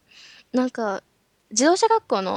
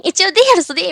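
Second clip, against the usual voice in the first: higher, loud huffy claim; indignant protest; 6 semitones higher, 7 dB louder than usual. Retold or imitated. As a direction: pout and insist on a D cup. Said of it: "う、うってなった時に、".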